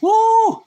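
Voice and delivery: sudden high whoop of delight; a loud happy woo; high excited woo. Real, not acted. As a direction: whoop with joy. Excited food exclamation.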